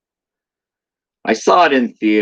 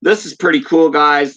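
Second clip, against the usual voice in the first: high, loud excitement; excited; 7 semitones higher, 5 dB louder than usual. Real, not acted. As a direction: gush over the find. Excited showing off promo item.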